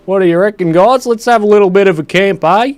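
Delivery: loud, high, hammed-up Australian accent; full Aussie. To his viewers, hammed-up loud Australian accent. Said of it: "I'm going full Aussie again".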